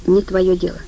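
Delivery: plain reading voice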